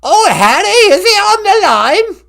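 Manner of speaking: high, loud comic British royal grandmother; piercing put-on English matriarch voice; Queen Mum. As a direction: shriek it like a hammed-up old monarch. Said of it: "It's the Queen Mum".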